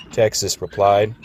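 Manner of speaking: usual speaking voice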